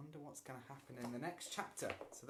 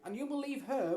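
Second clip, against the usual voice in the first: pleading; 13 semitones higher, 11 dB louder than usual. A narrator's reading. Boy pleading with mother.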